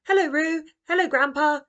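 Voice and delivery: a bright, chirpy mum at the door; cheery mum. A narrator's reading. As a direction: Mum greeting Roo and Grandpa, chirpy and loud.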